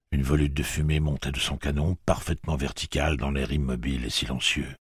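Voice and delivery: plain reading voice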